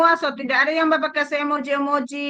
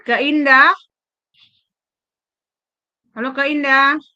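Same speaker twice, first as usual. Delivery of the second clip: calling out; 2 semitones higher, 6 dB louder than usual. Real, not acted. Calling participant who can't hear.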